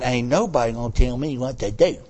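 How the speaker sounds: put-on low, stubborn kid voice; a mulish child refusing orders; defiant child.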